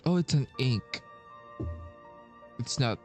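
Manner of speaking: ordinary delivery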